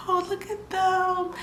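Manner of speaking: high, slow, soft cooing; cooing at babies. Retold or imitated. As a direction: coo over the tiny babies. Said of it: "I was like".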